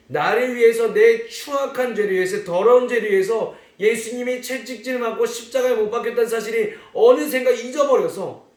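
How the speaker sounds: 열정적인 설교; impassioned preaching. Acted. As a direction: preach it hard to the kids. Preaching with full force.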